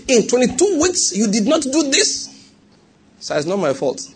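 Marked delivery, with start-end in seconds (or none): 0.1-2.3 much higher, slow, incredulous demand; outraged examiner. Retold or imitated. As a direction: repeat it back in disbelief, pitched way up. Angry examiner questioning student.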